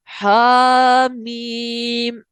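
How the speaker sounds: slow drawn-out recitation of हामीम; recited slowly. Shown as a demonstration. Quran verse reference recited. (हामीम धीमी तिलावत में)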